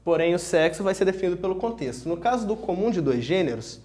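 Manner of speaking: normal talking voice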